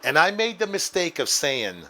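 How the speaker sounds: normal talking voice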